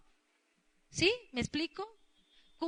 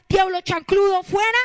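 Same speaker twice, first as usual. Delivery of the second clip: loud, higher-pitched mock casting-out; mock rebuke; 6 semitones higher, 11 dB louder than usual. Imagined believer ordering the devil out, loud mock rebuke. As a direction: raise your voice as if ordering the devil out. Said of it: "porque usted le diga".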